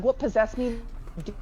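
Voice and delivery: ordinary talk, nothing marked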